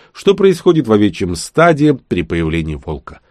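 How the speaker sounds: ordinary delivery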